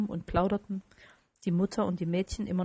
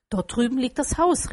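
higher, louder exclamation; crying out; 4 semitones higher, 6 dB louder than usual. A narrator's reading. Scrooge to the Spirit about his house, crying out. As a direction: call it out with raised voice. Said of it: "rief Scrooge".

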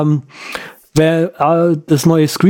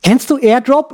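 put-on higher, eager young woman; 8 semitones higher than usual. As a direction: play the twenty-something friend. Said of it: "So ein junges Mädel".

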